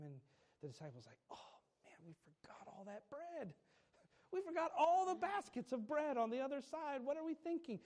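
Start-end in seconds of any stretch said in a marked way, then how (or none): 1.3-3.5 dismayed gasp. Retold or imitated. Disciples realise their mistake. 4.3-7.8 panicked disciples. Retold or imitated. Disciples panicking about bread.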